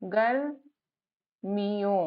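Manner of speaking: drawn-out reading demo. Shown as a demonstration. Teacher sounding out गर्मियों slowly for a child. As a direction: say गर्मियों very slowly, stretching each syllable. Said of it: "तो कैसे हो जाएगा".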